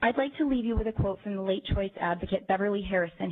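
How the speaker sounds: normal talking voice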